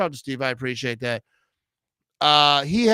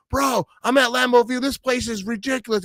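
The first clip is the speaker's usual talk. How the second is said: imitating an excited fan; 8 semitones higher than usual. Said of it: "And he's just excited".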